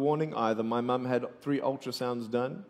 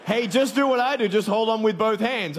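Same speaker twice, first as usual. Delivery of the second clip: much higher and louder, hammed-up pilot advice; mock nervous pilot; 9 semitones higher, 6 dB louder than usual. Fake pilot tells passengers to hold on, high and loud. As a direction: sell the joke as a jittery pilot.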